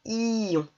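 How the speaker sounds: "ion" with a long i gliding into nasal on; drawn-out I. Shown as a demonstration. Teacher blending I and on, i drawn out. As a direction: hold the i of "ion" before the nasal. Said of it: "un I suivi du son on".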